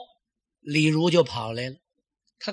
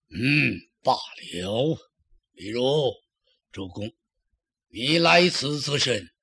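lower, very slow haughty lord; haughty old lord; 5 semitones lower, slower than usual. A narrator's reading. Dong Zhuo coldly asks Li Ru why he came, haughty and slow. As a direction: drawl the words out, aloof.

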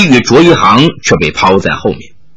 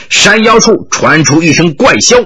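usual speaking voice

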